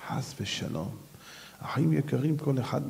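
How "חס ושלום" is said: breathy whisper, low; hushed whisper. Real, not acted. Preacher whispers to the congregation in awe. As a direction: drop to a whisper for חס ושלום.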